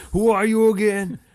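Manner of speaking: high, loud mock Southern holler; bayou swamp man. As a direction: holler it as a cartoon bayou local. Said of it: "the guy from the bayou".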